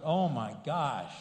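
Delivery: normal talking voice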